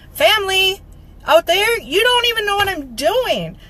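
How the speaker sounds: calling out. Real, not acted. Speaker calling to family who don't know her work, calling out. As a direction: call out high and emphatic.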